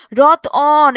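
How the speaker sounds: রতন with an emphatic long vowel between ত and ন; inserted vowel. Shown as a demonstration. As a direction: draw out the second vowel of রতন. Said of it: "এখানে একটা অধ্বনি আসছে না".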